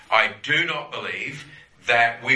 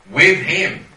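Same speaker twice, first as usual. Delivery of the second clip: "With" hit harder and louder, "him" sustained; louder repeat; 5 semitones higher, 9 dB louder than usual. Real, not acted. Preacher repeating "With him" to the class, louder. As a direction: swell the repeat, pressing "With".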